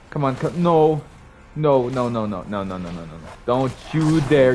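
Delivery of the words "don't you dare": slow warning. Real, not acted. Enemy about to hit.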